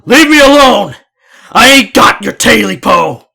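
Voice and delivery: high, loud frightened yell; a scared old man yelling at the creature; yelling back. Acted. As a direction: shout back in fear. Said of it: "Jake yelled back".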